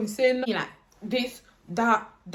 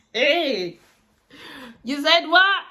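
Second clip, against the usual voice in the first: ordinary talk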